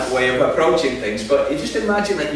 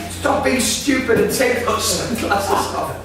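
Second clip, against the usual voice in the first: impatient command; 8 semitones higher than usual. Retold or imitated. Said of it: "she says".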